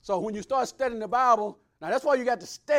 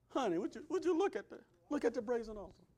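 much softer, gentle plea to a dying husband; wife pleading softly; 2 semitones higher, 10 dB quieter than usual. Retold or imitated. Wife begs her snake-bitten husband to look, soft pleading. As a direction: coax him softly to look. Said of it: "And she say".

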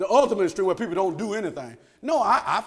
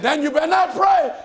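loud, higher mock warning; threatening officials; 7 semitones higher, 7 dB louder than usual. Officials threatening Daniel over his praying, mock angry shout.

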